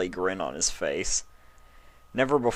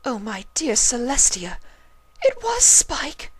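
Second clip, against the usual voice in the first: high, breathy whisper; shocked whisper; 4 dB louder than usual. A narrator's reading. Rarity realising the truth, whispered. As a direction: gasp it in horror. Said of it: "she thought to herself".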